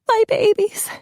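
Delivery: very high, crying wail; mother in tears for her children; tearful. A narrator's reading. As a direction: sob it out. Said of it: "Kelsey burst into tears".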